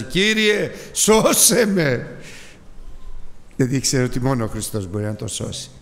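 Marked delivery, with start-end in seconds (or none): 0.0-2.2 high, loud, slow plea; drowning Peter calls out to the Lord; cry for help. Retold or imitated. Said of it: "Και φωνάζει". (κραυγή για βοήθεια)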